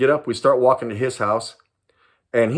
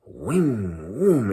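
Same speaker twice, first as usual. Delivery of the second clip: car swooshing noise; 2 semitones lower, 3 dB quieter than usual. Retold or imitated. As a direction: mimic cars swaying past in a half pipe. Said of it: "they start doing this".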